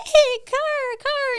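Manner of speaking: very high-pitched imitation of an excited little boy; happy, bubbly toddler. Mother imitating her two-year-old in the car, happy high squeal. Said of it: "happy and bubbly".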